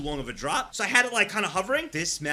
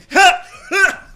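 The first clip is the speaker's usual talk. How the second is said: loud laugh; 14 semitones higher, 16 dB louder than usual. Real, not acted. Laughs at bug picture.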